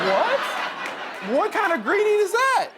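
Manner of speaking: loud, high-pitched disbelief with laughter; baffled, squeaky-high exclamation; incredulous outburst. Retold or imitated. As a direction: balk at it, pitch leaping way up. Reacts to absurd greeting instructions.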